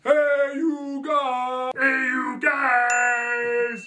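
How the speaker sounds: loud slow drawn-out shout; Sloth shout. Acted. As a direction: bellow slowly like Sloth. Sloth shouting movie line.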